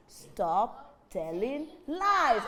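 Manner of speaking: very slow, firm scolding. Acted. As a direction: spell it out slowly to the liar. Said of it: "the villagers were very angry".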